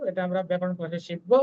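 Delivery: normal talking voice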